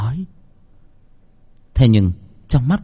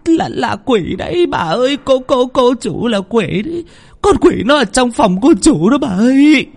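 much higher, trembling stutter, louder at the end; stammering in terror; 4 dB louder than usual. A narrator's reading. Cook stammers to grandmother about the demon, terrified. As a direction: stammer it out shaking with fear. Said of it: "run bần bật ... lắp bắp".

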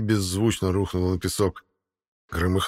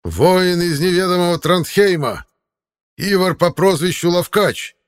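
slow, high, loud herald announcement; town crier; 10 semitones higher, 7 dB louder than usual. Acted. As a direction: draw out the name to the whole arena. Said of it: "возопил глашатай". (протяжный клич глашатая)

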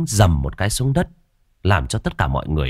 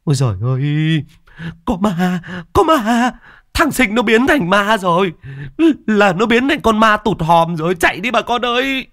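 shrill-high shrieks building louder; panicked screaming; 13 semitones higher, 4 dB louder than usual. A narrator's reading. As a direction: scream a warning to the whole village. Women flee screaming about a ghost. (la hét hoảng loạn, báo có ma)

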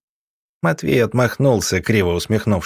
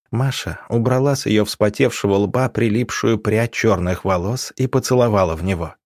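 ordinary delivery, nothing marked

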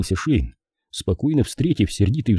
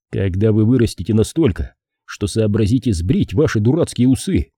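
ordinary delivery, nothing marked

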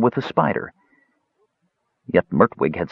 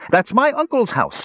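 high, emphatic declaration; declaring. A narrator's reading. Young elf recognises the house, declaring. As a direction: declare it with a leap in pitch. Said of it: "declared the young elf".

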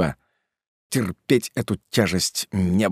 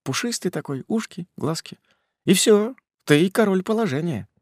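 high cooing lure voice; 9 semitones higher than usual. Retold or imitated. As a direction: lightly coo like a sweet-talker. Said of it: "Говоришь".

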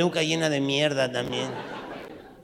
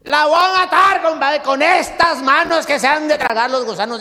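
high, loud furious vow; furious shout; 10 semitones higher, 8 dB louder than usual. Acted. Compadre swearing to kill his wife, high loud shout. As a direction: shout the threat like a maddened man.

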